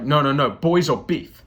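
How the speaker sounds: a hint of a tough Boston guy, insistent; lightly voiced Boston bro. Boston guy telling the comedian the rule, lightly voiced bro. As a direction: lightly mimic the local guy's firm rule. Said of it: "And he was like".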